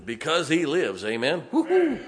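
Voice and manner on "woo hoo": high whooping cry of joy; joyful whoop. Acted. Preacher whooping to the congregation, high joyful cry. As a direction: whoop like the choir just lifted you. Choir song lit his fire.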